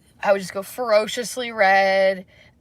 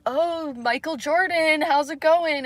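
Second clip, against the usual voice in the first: a hint of a high, slow teasing greeting; lightly mock his jokey hello; teasing coworker; 7 semitones higher, slower than usual. Imitating Kevin teasing her outfit, lightly high and slow. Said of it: "one time it was just like".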